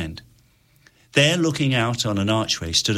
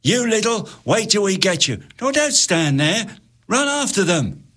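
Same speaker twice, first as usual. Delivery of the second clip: high, urgent soldier's shout; a pursuing soldier yelling at his men; 11 semitones higher than usual. Acted. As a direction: bark the chase order. Soldiers shout after fleeing children.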